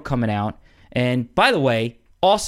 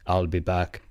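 Arnold impression; 6 semitones lower than usual. Riffing on actors' signature lines, lightly doing Arnold. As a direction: drop low and deliver it like the Terminator. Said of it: "Arnold has his thing".

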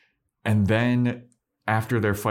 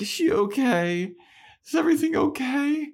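very high, squeaky worried voice; hammed-up anxious falsetto; mock worried Willow. Willow checking on injured Dawn, high mock-worried voice.

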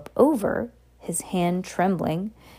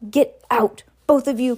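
croaked, a bit higher; croaking; 6 semitones higher, 4 dB louder than usual. A narrator's reading. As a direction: croak it out hoarsely. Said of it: "croaked Uncle Vernon".

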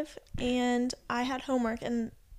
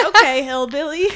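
loud, teasing jab with a rising end; mock teasing; 12 dB louder than usual. Imitating Sam mocking her pronunciation, loud teasing. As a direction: tease her loudly, half laughing. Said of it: "he's always just like".

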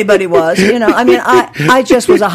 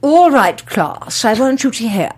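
imitating a haughty elocution teacher; drawn-out, over-enunciated classroom lecture; evil teacher; slower than usual. Speech teacher to the class, haughtily precise. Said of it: "the teachers used to be so evil".

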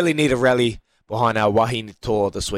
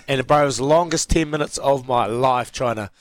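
normal talking voice

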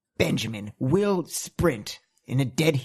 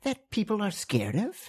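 scornful; 7 semitones higher than usual. Acted. Cat character scoffs at creature.

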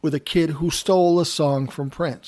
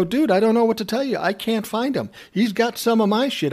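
higher and fast, exasperated; 7 semitones higher than usual. Retold or imitated. Narrator telling the A and R guy he can't find the kid, fast and fed up. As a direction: rattle it off, fed up.

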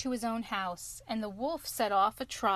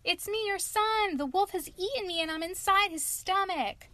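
shouting; 9 semitones higher, 4 dB louder than usual. Acted. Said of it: "Tom shouted".

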